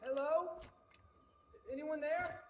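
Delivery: high, searching call into the distance. Acted. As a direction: call out to whoever is there. Calling out into the dark.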